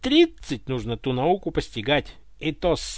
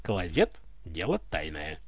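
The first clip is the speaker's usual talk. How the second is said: serious; 11 semitones lower, 8 dB quieter than usual. Acted. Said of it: "не понял шутки царь".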